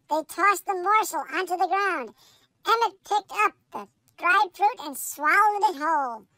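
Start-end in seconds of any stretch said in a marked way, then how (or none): none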